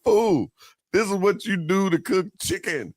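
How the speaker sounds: high, exasperated mock telling-off; snapping. Mechanic scolding the kids over vegetable oil, mock snapping. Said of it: "he came out there and he snapped".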